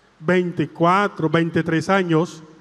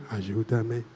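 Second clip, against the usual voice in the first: much lower and softer ayúdame; hushed echo; 11 semitones lower, 10 dB quieter than usual. Shown as a demonstration. Priest gives the girl's soft reply to the prayer. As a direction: murmur it back. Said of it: "Empezamos otra vez".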